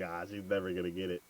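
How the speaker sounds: quick, low and soft; hushed dismissal to himself; inner thought. Retold or imitated. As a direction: toss it off as a private thought. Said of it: "in his head, he's like".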